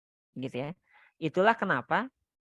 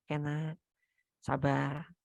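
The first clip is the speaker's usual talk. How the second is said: put-on gently soothing, softer, to a tantrum child; soft soothing whisper-like; 2 semitones lower, 6 dB quieter, much slower than usual. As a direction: hush the child softly. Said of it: "kita masukin bisikan-bisikan yang baik". (berbisik lembut menenangkan anak)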